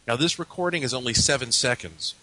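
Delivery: ordinary delivery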